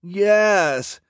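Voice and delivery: high, loud drawn-out yes; calling back. A narrator's reading. Villainess answers her boyfriend as she approaches, calling back. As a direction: call it across the smoke. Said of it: "her voice growing closer".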